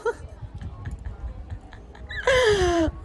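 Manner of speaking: long high-pitched delighted laugh; squealing happy laughter; giddy high laugh. Real, not acted. Laughing at own story.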